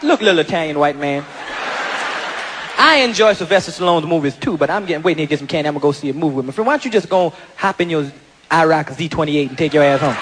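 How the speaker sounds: deep, unbothered reply. Retold or imitated. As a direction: brush him off coolly. Big man answers calmly.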